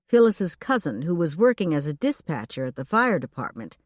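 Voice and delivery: ordinary delivery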